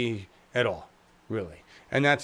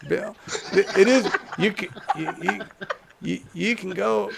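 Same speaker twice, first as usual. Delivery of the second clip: laughing; 5 semitones higher than usual. Real, not acted. Cracking up mid impression.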